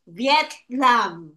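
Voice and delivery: "Vietnam" slowly split into two beats; syllable by syllable. Shown as a demonstration. Teacher showing a child two syllables, "Vietnam" said syllable by syllable. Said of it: "two syllables, we have".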